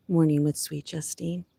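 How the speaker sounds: ordinary talk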